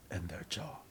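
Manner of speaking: usual speaking voice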